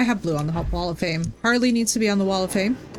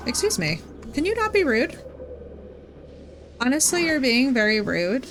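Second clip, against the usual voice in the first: much higher Valley girl voice, mock offended; Kim Kardashian voice; 4 semitones higher than usual. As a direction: play the offended, high Valley girl scolding the chat. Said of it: "I only bring out the Kim Kardashian voice".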